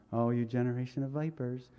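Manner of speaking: soft, gentle, polite mock reading; too nicely. Shown as a demonstration. Preacher showing how John did not say it, mock-gentle and soft. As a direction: say it sweetly as if it were nice. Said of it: "he didn't say this nicely".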